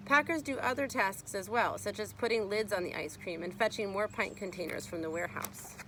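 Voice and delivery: usual speaking voice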